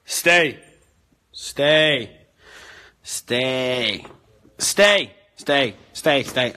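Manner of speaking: loud, firm commands to the dog. Real, not acted. Repeated commands to dogs.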